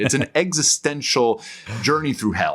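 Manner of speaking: a dramatic pitch that makes the story sound exciting; dramatic reframe. Pretend. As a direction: sell it as thrilling instead of dry. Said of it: "Much better, yes".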